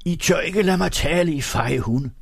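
high-pitched, indignant taunt; angry defiance. A narrator's reading. Egholm insults those who would silence him, high angry defiance.

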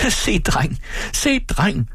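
very high-pitched cry at normal volume; narrated call. A narrator's reading. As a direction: call it twice, pitch leaping up. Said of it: "råbte moren, der vred sig af latter". (lyst, højt tilråb uden fuld styrke)

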